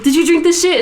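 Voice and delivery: high indignant squeal; mock outrage. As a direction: act shocked at the drink theft. Retelling confronting coworker.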